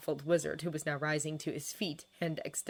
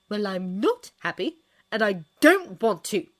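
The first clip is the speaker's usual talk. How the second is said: loud, higher, defiant declaration; forceful refusal, voice raised; declaring; 4 semitones higher, 9 dB louder than usual. A narrator's reading. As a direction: declare it firmly, hitting "don't". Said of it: "she declared".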